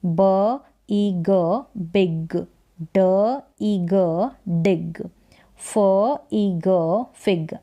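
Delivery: slow phonics, each sound alone, then "बिग", "डिग", "फिग" blended; sounding out short i. Shown as a demonstration. Teacher reading small spellings to learners, "ब ई ग" slowly sounded out, then "बिग". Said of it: "आई को ई पढ़ रहे हैं".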